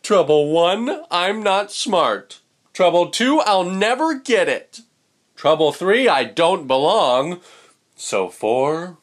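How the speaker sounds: loud, high mopey chant of woes; glum fish voice. Acted. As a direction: wail the troubles like a sulky little fish. Pout fish lists his troubles.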